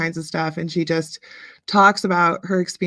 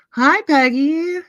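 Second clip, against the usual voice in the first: high, louder, slow hi Peggy; light up at seeing a friend; excited greeting; 8 semitones higher, 6 dB louder, slower than usual. Real, not acted. Greeting Peggy as she joins the stream, excited. Greets a viewer who just arrived.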